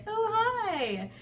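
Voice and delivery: high, drawn "oh" dropping onto "hi"; surprised greeting. Retold or imitated. Meeting a friend at the corner, mock surprised greeting. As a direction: act out a high-pitched surprised hello.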